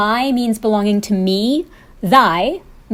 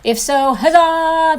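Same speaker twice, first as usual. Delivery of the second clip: cheery huzzah; 7 semitones higher, 5 dB louder than usual. Acted. Cheering the archaic-savvy viewer.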